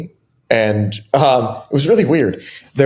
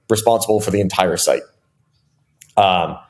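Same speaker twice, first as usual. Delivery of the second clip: normal talking voice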